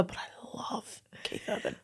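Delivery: whispering. Real, not acted. Moved, whispered confession.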